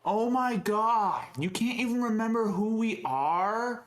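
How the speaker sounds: the fed-up baker scolding the idiot; exasperated baker. Acted. Baker berating the rich idiot customer, exasperated and high. Improv scene, baker character.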